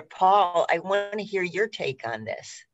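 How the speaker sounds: ordinary delivery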